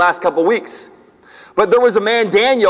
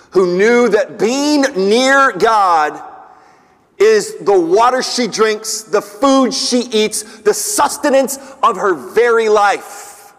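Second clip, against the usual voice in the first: slow, weighty phrasing; slow emphatic preaching; slower than usual. Real, not acted. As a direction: lay each phrase down slowly. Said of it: "slow emphatic preaching".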